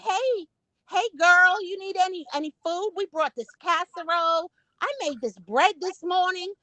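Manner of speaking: high-pitched neighbourly greeting; friendly neighbour lady. Retold or imitated. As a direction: imitate an eager neighbour offering food. Voices welcoming Southern neighbour ladies.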